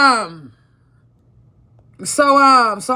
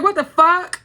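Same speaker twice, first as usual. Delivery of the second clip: shocked outburst; 8 semitones higher, 4 dB louder than usual. Retold or imitated. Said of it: "I'm like".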